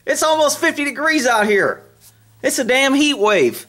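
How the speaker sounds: high, teasing voice for the horses; high pet talk. Acted. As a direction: tease the horses in a lifted voice.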